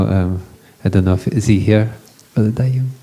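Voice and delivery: ordinary talk, nothing marked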